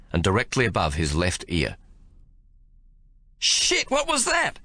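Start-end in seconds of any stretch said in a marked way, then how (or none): 3.4-4.6 put-on high, startled cry of a frightened young soldier; shocked howl. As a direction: blurt it out in sudden fright. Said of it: "Cooligan howls".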